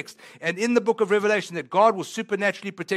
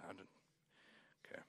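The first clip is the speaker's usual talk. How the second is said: ordinary delivery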